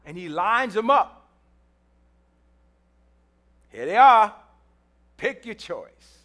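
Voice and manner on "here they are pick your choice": booming, then trailing off father's voice; a loud proud father with a hint of dismissal; father presenting his sons. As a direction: show off the sons to the prophet.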